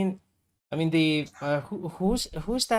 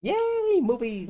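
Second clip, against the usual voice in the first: excited; 11 semitones higher, slower than usual. Real, not acted. Cheering about movies.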